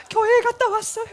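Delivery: high, loud wailing answer; imitated tearful teen. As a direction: act out a crying child answering the mother. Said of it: "눈물을 흘리면서". (울먹이며 크게 대답하는 아이 흉내)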